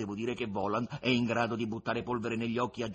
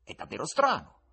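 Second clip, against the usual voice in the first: the guest, mock-incredulous; 11 semitones higher, 9 dB louder than usual. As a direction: scold him with amused disbelief. Guest voiced high and loud.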